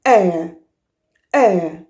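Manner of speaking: "Air" with a clear glide between two vowels; gliding air. Shown as a demonstration. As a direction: slide from one vowel to the other in "Air". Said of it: "you have to glide".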